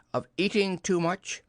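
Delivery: high, pointed reproach; lightly acted stern rebuke. A narrator's reading. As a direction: scold primly as a fussy character. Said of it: "said Rabbit sternly".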